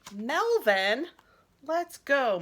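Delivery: mom calling. Acted. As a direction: call him like a hurried mom. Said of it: "Mom calls".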